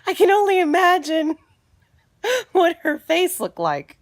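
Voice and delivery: high, loud laugh through the words; can't stop laughing while telling it. Real, not acted. Picturing the other mom's face, laughing loudly.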